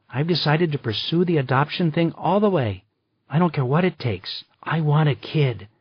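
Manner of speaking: a determined friend announcing her big decision; a hint of a bold, excited woman; Maggie's voice. A narrator's reading.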